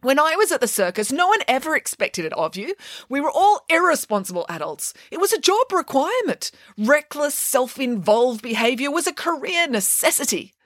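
high, whiny complaint about responsibility; lightly voiced nanny pig grumbling about being grown-up; complaining pig nanny. A narrator's reading. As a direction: moan like a spoiled circus star. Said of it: "complained Nanny Piggins".

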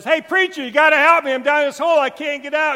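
loud, high desperate plea; calling out for help. Acted. Man stuck in a hole begs a passing pastor, loud high plea. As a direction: shout up to the pastor, begging.

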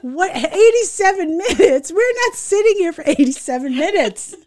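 high-pitched comic disbelief; incredulous outrage. Retold or imitated. Aunt hearing triple A's long wait, incredulous outrage. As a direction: protest the wait in mounting amused outrage.

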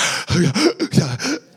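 a mock winded gasp; mock gasp for breath. Shown as a demonstration. Preacher showing how he sounds running, mock gasp. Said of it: "When I run and talk".

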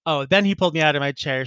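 ordinary talk, nothing marked

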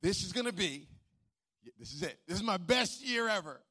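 excited cheer. Retold or imitated. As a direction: hype yourself up for the best year ever. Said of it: "We start off going".

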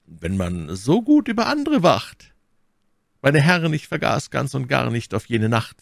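anger hidden behind a smile; Cardinal, smiling. A narrator's reading. Cardinal smiles at the musketeers, hiding his anger. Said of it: "sein Ingrimm in ein Lächeln verwandelt".

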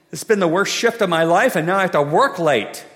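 loud, put-upon griping; hammed-up exasperated server; exasperated complaint. Weary server complains to customer.

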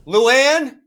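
loud, high call through the door; summoning the mother from outside. Real, not acted. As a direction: call her name into the hallway. Calling out the door.

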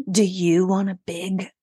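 slow, teasing sales pitch; drawn-out seductive product pitch; sultry ad voice. Pretend. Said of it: "you'll be like".

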